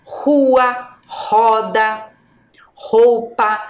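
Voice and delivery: rua, roda, roupa, each opening on a strong H-like R; one word at a time, rua, roda, roupa, pushing the first R; separated model words rua, roda, roupa with strong R; strong initial R. Shown as a demonstration. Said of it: "tem a pronúncia forte".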